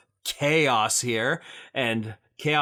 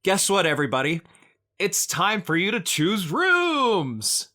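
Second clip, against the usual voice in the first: excited announcement; 8 semitones higher, 4 dB louder than usual. Real, not acted.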